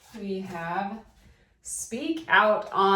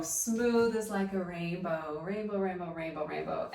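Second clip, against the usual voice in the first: smooth and flowing; 3 dB quieter than usual. Shown as a demonstration. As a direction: draw the words out like a rainbow. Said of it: "when I'm mimicking smooth and choppy".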